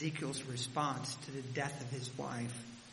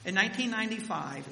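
emphatic; 6 semitones higher, 6 dB louder than usual. Real, not acted. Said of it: "emphatic repeat of date".

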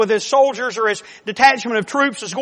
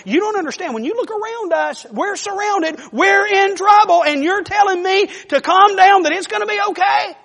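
high, frantic, disbelieving protest; a frightened servant arguing with his master, pitched up; panicked pleading; 10 semitones higher, 4 dB louder than usual. Acted. Servant panics, surrounded by army.

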